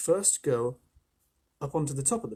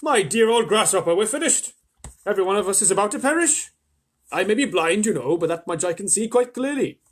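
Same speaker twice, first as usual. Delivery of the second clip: higher, louder doom-laden worm; despairing earthworm; 6 semitones higher, 8 dB louder than usual. A narrator's reading. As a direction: moan it all as a lost cause.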